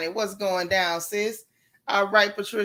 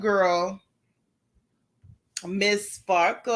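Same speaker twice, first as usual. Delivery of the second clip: ordinary delivery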